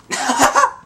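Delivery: sudden high-pitched cackle; high laugh. Real, not acted. Player laughing after a kill, high cackle. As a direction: burst out in a high giggle.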